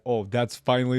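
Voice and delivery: ordinary talk, nothing marked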